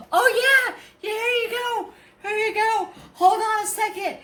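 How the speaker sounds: very high put-on voice; excited cashier. Cashier handing his card back, high excited imitation. Flustered fan returns card, retold.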